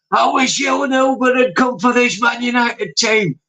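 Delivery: mock ranting shout. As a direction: imitate an exasperated fan ranting at his team. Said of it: "Sounds like me shouting at Rashford".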